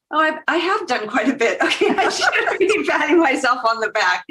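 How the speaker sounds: high, giggling "I have done quite a bit"; laughing self-praise. Retold or imitated. Guest laughing at herself after her bio, giggling and high. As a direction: laugh at yourself as you realise it.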